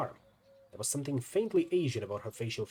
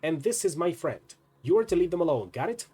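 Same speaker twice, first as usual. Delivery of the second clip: higher, louder stern order; forceful, insistent command; firm warning; 5 semitones higher, 6 dB louder than usual. A narrator's reading. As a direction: lay down the law to the drunks. Firm order to drunken men.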